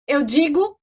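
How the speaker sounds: normal talking voice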